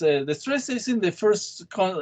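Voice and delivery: ordinary talk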